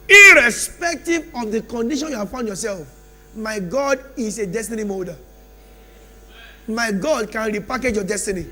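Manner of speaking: loud, rising sermon bursts with long pauses; preaching at full force. Acted. Preacher promising the congregation change, loud and rising. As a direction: drive the promise home to the church.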